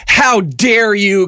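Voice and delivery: very slow, high indignant gasp; self-righteous. Retold or imitated. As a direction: drag it out, outraged and smug. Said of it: "He's like".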